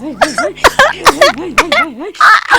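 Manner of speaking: very high, squealing fake sobs; mock sobbing laugh. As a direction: break into hammed-up laughing wails. Hammed-up crying in sympathy.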